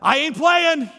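loud, high, punchy exclamation; emphatic catchphrase. Retold or imitated. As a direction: belt out the pastor's saying with force. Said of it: "You know what Pastor Hurst says?".

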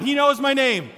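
yell it out. Shown as a demonstration. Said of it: "Yell that out".

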